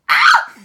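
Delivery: very high joyful shriek; real delighted scream; excited scream. Real, not acted. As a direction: shriek with glee. Card reveal joy.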